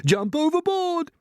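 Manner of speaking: high, slow drawn-out cry; panicked yell. A narrator's reading. Creature urging the others to jump overboard, panicked yell. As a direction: yell out a desperate order.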